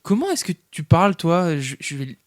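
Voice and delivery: loud, high-voiced offended comeback; indignant retort. Ray answering Eddie's swearing with a threat to tell his mother, indignant. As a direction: scold back, threatening to tell on him. Player talks back to yelling Eddie.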